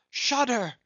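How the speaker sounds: high, loud cry; frantic cry. A narrator's reading. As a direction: cry out in alarm. Repeated cry.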